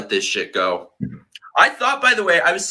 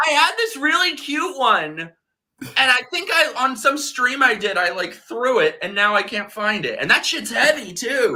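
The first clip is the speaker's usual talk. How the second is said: high, tipsy chatter; 13 semitones higher than usual. Real, not acted. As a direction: talk a little tipsy. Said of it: "I'm a little tipsy now".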